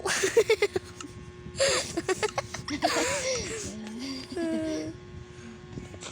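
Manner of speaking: long giggling fit. Real, not acted. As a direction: break into giggles. Bursts out laughing.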